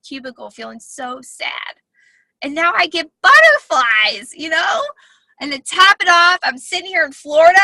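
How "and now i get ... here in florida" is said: bursting with real joy, louder and higher; excited. Real, not acted. Coach tells the group her thrilled news, excited. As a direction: gush about the butterflies, almost shouting.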